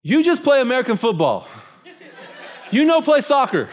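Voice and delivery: mock him through laughter; laughing tease. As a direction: translator laughing at the bad goalie. [name] mocking his soccer skill.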